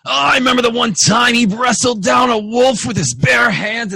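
high, loud boisterous dwarf; standard dwarf voice. Acted. Dwarf boasts about a dead friend, boisterous shout. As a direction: bellow it like a dwarf telling a tall tale. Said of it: "It's my standard dwarf voice, guys".

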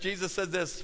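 ordinary delivery

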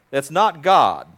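ordinary talk

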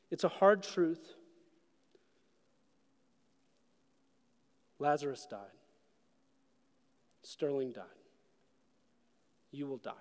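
hushed solemn close. Real, not acted. Preacher closing to mourners, solemn and hushed. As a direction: let each death land quietly.

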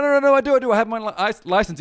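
loud, high, stumbling denial; acted frantic protest. Accused man offering his license, hammed-up frantic protest. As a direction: protest over them in a mock panic.

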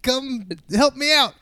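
high-pitched imitation of calling up from below; mock distant yell. Retelling a stuck character calling from downstairs, mock yell. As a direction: call out high and drawn-out, imitating a stranded man. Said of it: "Hector Martinez yells from downstairs".

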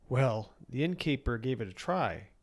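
usual speaking voice

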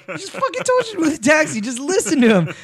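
high, fast exasperated protest; mock exasperation. Viewer scolding the movie character, exasperated.

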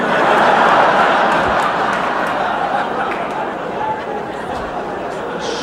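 real laughter, high and airy; wheezing laugh; long breathy laugh. Real, not acted. Laughs at own joke.

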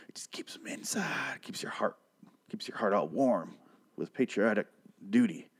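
hushed breathy whisper about the flag; mock solemn hushed whisper; mock reverent whisper. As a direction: whisper it like a sacred secret. Mock reverent patriotic hush.

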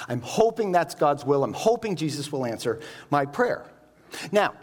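ordinary delivery

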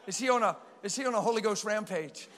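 ordinary delivery

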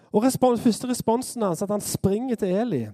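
usual speaking voice

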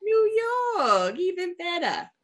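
high, hammed-up New York accent. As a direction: put on a showy New York voice. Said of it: "I could do a New York accent".

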